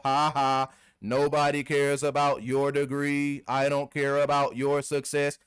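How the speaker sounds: monotone robot voice, slow and drawn out. Acted. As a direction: drone each line mechanically on one level pitch. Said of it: "You sound like a robot".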